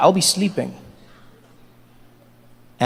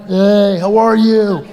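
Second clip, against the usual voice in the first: loud, drawn-out creepy come-on; hammed-up sleazy pickup voice; creepy guy; 4 dB louder, much slower than usual. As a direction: imitate a pushy creep at a bar. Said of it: "That creepy guy comes up".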